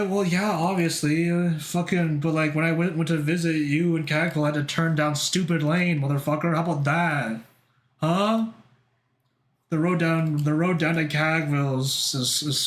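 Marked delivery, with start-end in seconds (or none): none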